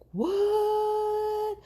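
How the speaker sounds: a high, disbelieving "What?"; shocked. Retold or imitated. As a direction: gasp it out in disbelief. Told foot is broken.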